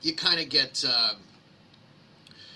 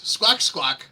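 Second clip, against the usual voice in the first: mock chicken squawk; 6 semitones higher, 4 dB louder than usual. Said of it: "what the chicken ... governor says".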